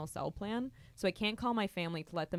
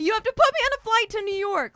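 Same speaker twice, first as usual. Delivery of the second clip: hysterical screaming; 12 dB louder than usual. Retold or imitated. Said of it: "I start screaming and crying hysterically".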